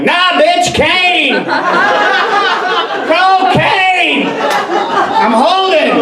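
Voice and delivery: high, drawn-out hollering; brash yelling. Pretend. Imagined six-year-old correcting his teacher about Coke, brash high yell.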